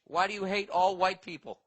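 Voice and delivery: ordinary delivery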